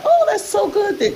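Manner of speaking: high-pitched imitation of own pleased reaction; retold delight. Speaker recalls seeing the choir director, high retold delight. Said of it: "and I was like".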